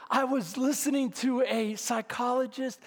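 ordinary delivery

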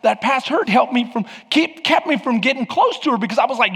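ordinary delivery

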